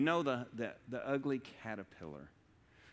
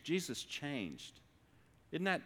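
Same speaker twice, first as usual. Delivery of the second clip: normal talking voice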